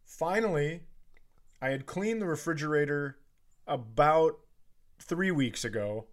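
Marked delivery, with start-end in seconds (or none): none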